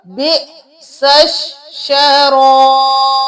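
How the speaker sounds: बे सश शराब with a jerk on the hamza, drawn out; glottal catch. Shown as a demonstration. Teacher reciting the word with a clear glottal stop. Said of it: "हमज़ा को झटका".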